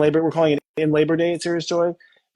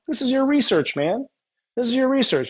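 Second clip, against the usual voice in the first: excited; 8 semitones higher than usual. Real, not acted. Excited reframing for caller.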